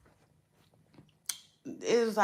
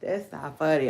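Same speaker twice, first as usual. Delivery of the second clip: normal talking voice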